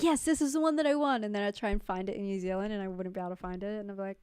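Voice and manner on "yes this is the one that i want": higher, lightly excited self-quote; eager, raised-pitch re-enactment; mock excitement. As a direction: act out a thrilled discovery. Acting out past excitement at a find.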